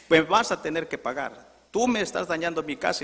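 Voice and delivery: loud, high acted accusation; angry demand. Acted angry neighbour demands payment. (exigencia airada en voz alta)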